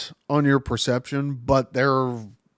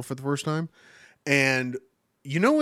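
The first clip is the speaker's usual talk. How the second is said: ordinary talk, nothing marked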